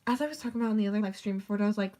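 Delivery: usual speaking voice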